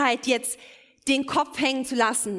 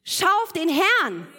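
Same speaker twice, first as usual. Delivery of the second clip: urgent preaching; 4 semitones higher, 3 dB louder than usual. Acted. Preacher urging congregation to look up.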